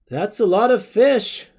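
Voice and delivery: quoting movie line. Retold or imitated. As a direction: blurt the cringe movie line out. Movie line flashes in mind.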